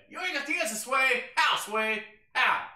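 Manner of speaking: high, loud [name] rant; shouted meme quote. Retold or imitated. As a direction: yell it like a famous outburst. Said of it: "[name] meme shouted".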